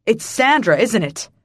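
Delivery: forceful, agitated questioning; urgent demand. A narrator's reading. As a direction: press them hard for confirmation. Father presses frantically for answers.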